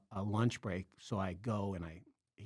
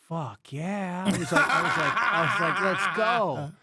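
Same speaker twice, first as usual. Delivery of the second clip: high, loud, laughing burst of eagerness; excited yes; 7 semitones higher, 8 dB louder, slower than usual. Retold or imitated. Telling how he jumped at the assistant job, excited and laughing loud. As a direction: jump at the offer, laughing with joy.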